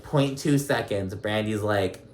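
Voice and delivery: ordinary delivery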